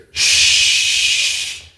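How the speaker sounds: drawn-out hushing shh; sliding sound. Retold or imitated. Popcorn retelling the glasses grab, long hissed shh. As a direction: hiss out a long, smooth slide.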